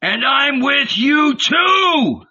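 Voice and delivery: high, drawn-out holler; slow, high-pitched wild yell; wild cry. A narrator's reading. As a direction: cry it out wildly. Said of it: "cried a wild voice".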